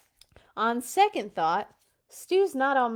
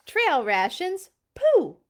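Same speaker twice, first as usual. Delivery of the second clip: loud, high scornful Pooh; scoffing Papa; 7 semitones higher, 9 dB louder, slower than usual. Acted. Papa mocking the kids' rations, loud scoff. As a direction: scoff at the idea, loudly.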